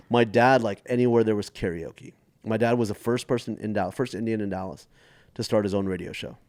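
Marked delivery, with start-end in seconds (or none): none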